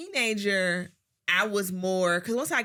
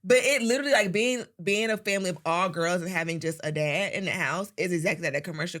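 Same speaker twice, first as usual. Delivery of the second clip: usual speaking voice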